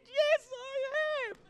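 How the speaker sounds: high, drawn-out mock husband voice; husband's exaggerated reply. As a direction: stretch it out high, playfully imitating him. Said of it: "I didn't say it like that".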